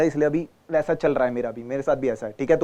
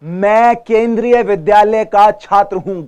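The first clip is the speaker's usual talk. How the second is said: slow, louder, "केंद्रीय" crisply stressed; clear articulation; 3 semitones higher, 9 dB louder, slower than usual. Shown as a demonstration. Teacher modelling a clear school introduction, slow. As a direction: speak it slowly and clearly. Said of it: "साफ, साफ बोले".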